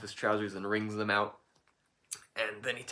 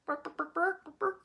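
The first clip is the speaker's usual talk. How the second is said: high yappy barks, rising each time; dog bark imitation. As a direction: yap out the barks like a little dog. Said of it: "make the sound like".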